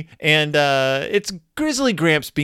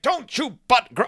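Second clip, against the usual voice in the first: high, sharp scolding grandpa; mock stern grandfather cut off mid-word; grumpy Gramps; 9 semitones higher than usual. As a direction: snap at the cubs like a cranky grandpa. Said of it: "and he's like".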